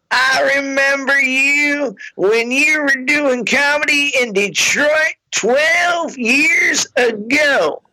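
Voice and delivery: high, slow, shouted fan; drunk lady fan. Retold or imitated. Toothless fan greeting the comic, high and slow. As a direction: call it out like a tipsy fan.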